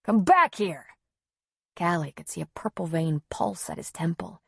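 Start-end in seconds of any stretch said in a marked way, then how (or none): 0.0-0.9 moderately raised angry command; shouted order. A narrator's reading. Husband at the foot of the stairs, shouted order. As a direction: call them back, louder and cross. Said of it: "he shouted after her".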